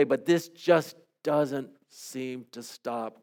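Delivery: praying a psalm of lament aloud; heartfelt prayer. Acted. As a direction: pour out a lament before God.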